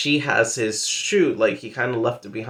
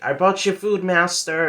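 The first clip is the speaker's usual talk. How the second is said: high, loud bat servant voice; high-pitched call to the master; talking bat servant; 7 semitones higher, 5 dB louder than usual. Retold or imitated. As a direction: pipe up loudly as an eager servant bat.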